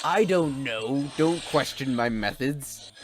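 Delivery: evil scientist. Acted. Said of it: "I'm supposed to be an evil genius. Evil scientist".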